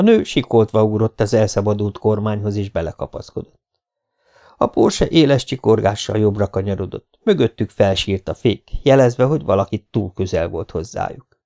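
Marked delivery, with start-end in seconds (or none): none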